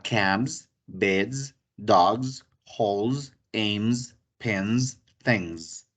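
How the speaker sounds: each final s buzzed as a z, "cabs", "beds", "dogs"; teacher modelling plurals that end in a buzzing z; final z demo. Shown as a demonstration. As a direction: buzz the ending of every word. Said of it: "la S va a tener una pequeña vibración".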